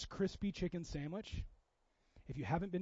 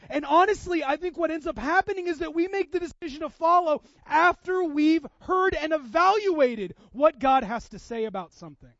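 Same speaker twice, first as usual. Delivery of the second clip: loud, higher, driving sermon delivery; full-force preaching; 5 semitones higher, 11 dB louder than usual. Acted. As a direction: preach it hard to the congregation.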